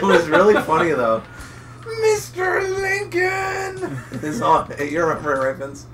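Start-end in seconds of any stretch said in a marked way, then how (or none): 2.0-4.0 high, long-drawn call of a name; drawn-out mock cry. Friend re-enacting the funny moment they remember, drawn-out mock cry. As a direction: wail the name out slowly, way up high.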